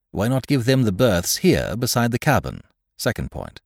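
emphatic, pointed delivery; lightly voiced blunt captain. Captain listing precautions for the gentlemen, lightly voiced and firm. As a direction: lay out demands point by point.

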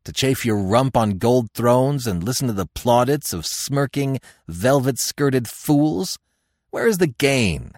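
loud, scornful anger, pitch climbing; angry. A narrator's reading. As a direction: demand angrily, drawing out the contempt. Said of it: "he demanded angrily".

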